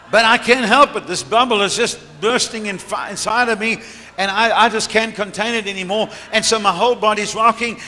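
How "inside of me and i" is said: usual speaking voice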